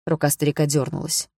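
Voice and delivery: usual speaking voice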